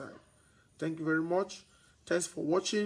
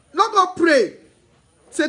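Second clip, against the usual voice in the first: loud scolding; 10 semitones higher, 14 dB louder, slower than usual. Real, not acted. Scolding fearful property sellers.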